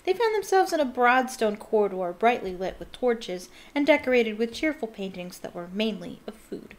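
usual speaking voice

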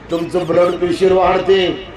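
ordinary talk, nothing marked

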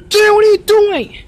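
high, loud call to Junior; calling out sharply. Acted. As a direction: snap at the kid across the store. Parent catches child misbehaving.

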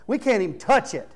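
ordinary delivery

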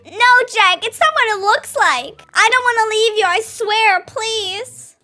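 desperate pleading. Acted. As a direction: plead your innocence in acted panic. Caught girlfriend pleads with Jack.

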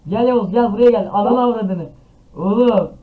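loud high panicked shout; frantic yelling. Real, not acted. Frantic calling during attack.

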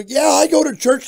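loud, high, flustered reply; imitated freak-out. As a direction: mimic a rattled householder. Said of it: "people would freak out and go".

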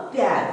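प्यार as one quick beat, pyaar; half प sound. Shown as a demonstration. Teacher modelling the joined letters, half-प pronunciation. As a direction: clip the प straight into य in प्यार. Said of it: "आधी हो जाएगी".

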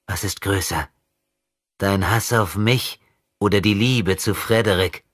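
put-on a demon in a child's body toying with his enemy; possessed boy taunting. Possessed boy mocking André's rescue, sinister.

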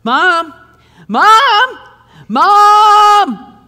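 put-on high, loud, rising child's call for Mom; kid calling for mom. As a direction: yell like a little kid hunting for his mother. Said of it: "and he goes".

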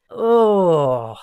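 long, loud falling groan; exasperated groan. Real, not acted. Reviewer venting about characters who boil his blood, exasperated groan. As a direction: let out a frustrated groan.

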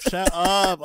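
loud, laughing protest, pushing the joke away. Real, not acted. Co-host cutting off a silly Scooby joke, loud mock protest. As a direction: Shut up thrown out loud and emphatic.

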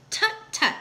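high-pitched little tut-tut; dismissive tutting. A narrator's reading. Maisie answering the doubtful elephant, dismissive tutting. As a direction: brush off the objection lightly.

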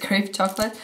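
usual speaking voice

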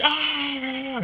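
high-pitched acted war cry; long, high "aah" yell; battle scream. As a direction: scream as the warrior charging in, not at full volume. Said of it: "and just screams".

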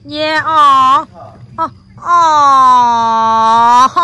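loud, long drawn-out aww; cooing "aww". Real, not acted. Parent watches two children hug, loud cooing. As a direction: coo loudly at something adorable.

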